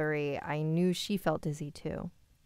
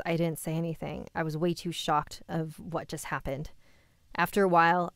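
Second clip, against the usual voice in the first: plain reading voice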